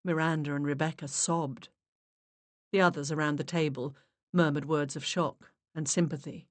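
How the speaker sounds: ordinary delivery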